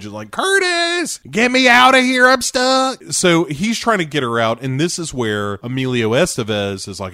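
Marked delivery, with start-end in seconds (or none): 0.3-3.0 very high, loud comic wail; mock panicked shriek; high-pitched cry for help. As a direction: squeal for rescue like someone trapped. Acting trapped character calling for help.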